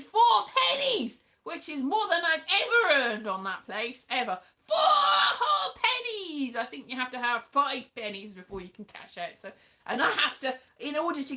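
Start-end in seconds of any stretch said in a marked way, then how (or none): 4.7-6.6 high, loud and slow, laughing; mock triumph. Real, not acted. Celebrating four pennies earned with a loud laughing mock cheer. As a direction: shout it like a jackpot win.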